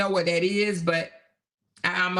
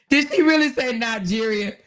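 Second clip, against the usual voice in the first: laughing; 5 semitones higher, 5 dB louder than usual. Real, not acted. Laughing at chat comment.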